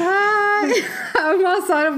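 "ai" agudo no meio da gargalhada; rindo muito. Real, not acted. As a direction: rindo quase chorando. Gargalhada depois da pegadinha. (ai entre risadas)

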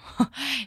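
brief, soft chuckle; little laugh at your own remark; light laugh. Real, not acted. Teacher joking about loving popcorn, brief chuckle.

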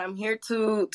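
usual speaking voice

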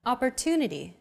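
Opportunity with a longer, stressed "tu". Shown as a demonstration. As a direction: lean on the third syllable of Opportunity. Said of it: "Compare this once again with Arabic".

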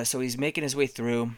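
usual speaking voice

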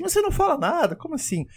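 high-pitched, incredulous complaint; put-on high voice of puzzled disbelief; mock bewildered coworker. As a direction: squeak it out, baffled at being ignored. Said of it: "ele fica assim".

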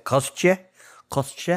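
tentative mock Russian accent, rising in question; dumb Russian accent. Shown as a demonstration. Co-host testing how to say the villain's name, dumb Russian accent. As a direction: try it out in a silly Russian accent. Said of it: "trying to do it in a dumb Russian accent".

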